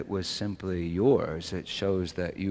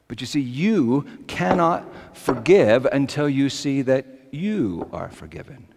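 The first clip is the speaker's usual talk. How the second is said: louder, higher impassioned preacher's call; full-force preaching; 8 semitones higher, 10 dB louder than usual. Acted. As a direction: drive it home to the congregation.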